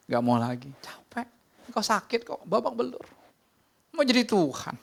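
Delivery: high, urgent preacher's cry; fiery sermon climax, voice soaring; impassioned preaching. Acted.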